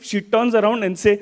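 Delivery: ordinary delivery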